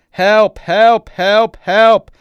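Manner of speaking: high, very loud repeated screams; full-voiced cries for rescue; screaming for help. A narrator's reading. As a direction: scream it out in rising panic. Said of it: "continues screaming".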